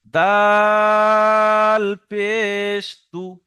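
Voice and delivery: very slow, loud long-held দাল; drawn-out teacher's spelling drill; chopped-up spelling. Shown as a demonstration. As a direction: spell it piece by piece, stretching each sound. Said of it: "বানান করার সময় কেটে কেটে".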